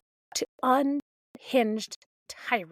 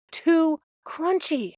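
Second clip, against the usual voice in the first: incredulous repeat; 5 semitones higher, 4 dB louder, slower than usual. Real, not acted. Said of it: "because their toast is too crunchy".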